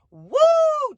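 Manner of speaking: high, loud whoop of relief; excited whoop. Real, not acted. Mom telling viewers about the ER, whooping loud. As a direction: let out a big shrieking woo. Excited cheer mid story.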